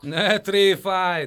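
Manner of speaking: slow, loud mocking laugh; clanking laughter. A narrator's reading. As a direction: laugh him off. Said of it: "nevettek csörömpölve".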